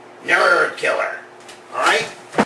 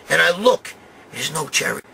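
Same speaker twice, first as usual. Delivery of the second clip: normal talking voice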